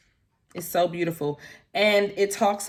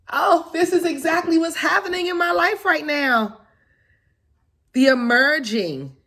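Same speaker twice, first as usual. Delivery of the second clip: excited; 10 semitones higher, 5 dB louder than usual. Real, not acted. Reacting to the Fool card.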